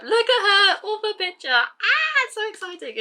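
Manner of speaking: very high, loud fangirl shriek; excited squeal. Real, not acted. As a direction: shriek with delight at the photo. Seeing author's picture on book.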